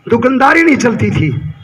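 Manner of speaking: usual speaking voice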